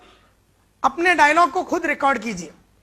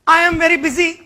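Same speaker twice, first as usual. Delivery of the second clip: louder, brisk excuse; customer brushing off; 4 semitones higher, 6 dB louder, much slower than usual. Retold or imitated. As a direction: wave off the salesman. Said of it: "बोलता". (ग्राहक ज़ोर से टालता है)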